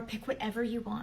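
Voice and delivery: normal talking voice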